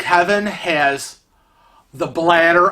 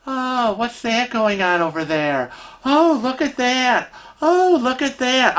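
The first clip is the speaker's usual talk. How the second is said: mock wonder; 7 semitones higher than usual. Wife mimicking husband distracted on the way out, mock wonder. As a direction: marvel at it like an easily distracted child.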